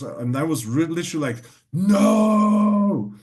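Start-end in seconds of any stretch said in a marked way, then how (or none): 1.7-3.1 high yelled NO, shouted in alarm; retold scream. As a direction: yell the refusal, loud and high. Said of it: "I was, like, screaming".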